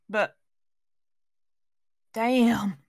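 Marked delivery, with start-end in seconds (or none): none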